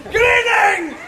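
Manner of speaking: bright, high call to the crowd; loud greeting. Acted. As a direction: hail the group cheerfully.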